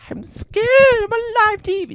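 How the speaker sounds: very high, squeaky frightened elephant; high trembling creature voice; scared elephant impression. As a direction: play a terrified elephant on camera. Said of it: "This is my impression of an elephant".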